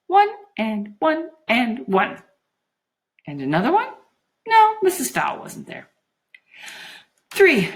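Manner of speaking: put-on childlike working-out; mesmerised Juliet, counting. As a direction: count laboriously in a happy daze.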